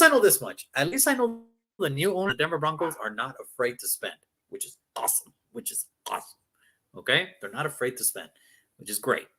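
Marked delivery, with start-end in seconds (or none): 4.5-6.4 whispered aside. Real, not acted. Aside about spending owner.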